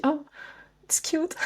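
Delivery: high, soft imitation of someone charmed; high-pitched quoted cooing; imitated cooing. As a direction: coo it high, quoting an admirer. Quotes others' reaction to her accent.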